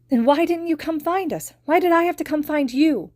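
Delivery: put-on high, loud crying child; a little girl's anguished outcry; tearful accusation. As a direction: demand it through tears. Crying girl accuses hero.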